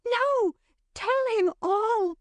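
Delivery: very high, drawn-out plea; slow, high-pitched entreaty; urgent cry. A narrator's reading. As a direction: cry out high, begging for the truth. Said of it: "cried Dot".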